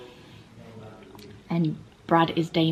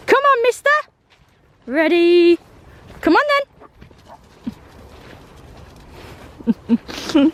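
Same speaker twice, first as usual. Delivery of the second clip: much higher, louder coaxing; high sing-song dog call; high pet voice; 11 semitones higher, 4 dB louder than usual. Real, not acted. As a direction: egg the dog on in a squeaky call.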